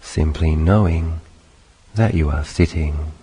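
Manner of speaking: measured meditation guidance in short phrases; unhurried meditation teacher, spacious phrasing; guided meditation. Acted. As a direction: guide them gently through it, long pauses between phrases.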